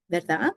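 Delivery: VERDAD rising at the end; tag-question intonation. Shown as a demonstration. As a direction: lift "verdad" to ask for confirmation. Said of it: "intonation on just".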